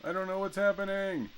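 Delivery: exasperated high-pitched complaint; high exasperated whine. Real, not acted. Repeated confusion, much higher.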